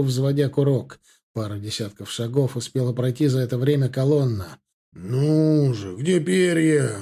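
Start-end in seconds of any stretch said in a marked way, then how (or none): 4.9-7.0 slow, drawn-out impatient question; impatient growl. A narrator's reading. Rifleman scanning the enemy ranks, impatient growl. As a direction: growl it while hunting a target. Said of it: "прорычал егерь".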